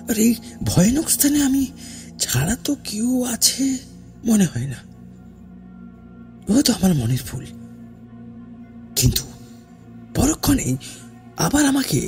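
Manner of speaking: ordinary delivery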